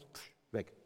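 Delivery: voiceless puff of air, then a soft "weg"; a breathy puff as the poem vanishes; breathy pff. Acted. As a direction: blow the word away like a lost memory. Blank mind in front of class.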